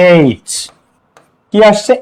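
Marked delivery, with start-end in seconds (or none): 0.1-0.5 এইচ said alone as a letter name, opening on an "ay" vowel; letter-name pronunciation. Shown as a demonstration. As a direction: demonstrate এইচ as "aych", set apart. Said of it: "তাহলে এইচ আমরা উচ্চারণ করব".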